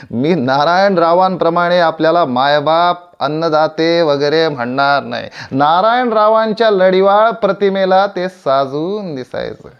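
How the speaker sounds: loud, high stage oratory; haughty declaration. Acted. Actor refusing to flatter his audience, loud and haughty. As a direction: declaim it to the back row.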